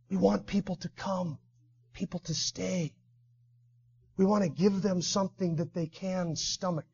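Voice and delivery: very soft, hushed pleading; timid objection. Acted. Timothy worrying to Paul that people will leave, hushed timid plea. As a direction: shrink back and plead very softly.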